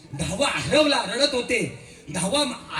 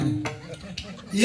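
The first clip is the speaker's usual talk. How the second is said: ordinary delivery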